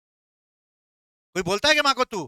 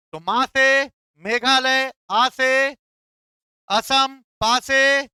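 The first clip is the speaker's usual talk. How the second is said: slow mnemonic recital; 5 semitones higher, 6 dB louder, slower than usual. Shown as a demonstration. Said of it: "mnemonic repeated as summary".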